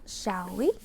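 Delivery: ordinary delivery, nothing marked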